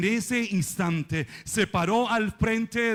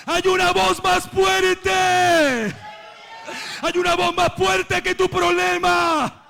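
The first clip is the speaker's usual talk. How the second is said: shouted refrain, easing towards the end; high, ringing pulpit shout; preaching at full force; 5 semitones higher, 4 dB louder than usual. Real, not acted. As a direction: proclaim it over the whole congregation.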